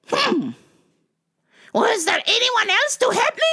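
very high, put-out shopper voice; huffy customer. Retold or imitated. Impersonates huffy customer seeking someone else.

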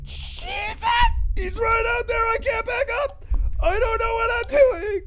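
high-pitched frantic wail after a scare; high panic. Real, not acted. Player cornered with enemy right outside, high panic. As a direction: cry it out in helpless panic.

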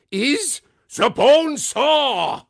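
put-on loud, high, very drawn-out German-accented reveal; exultant German Medic. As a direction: proclaim it slowly like a triumphant German doctor. German Medic announces the bone saw.

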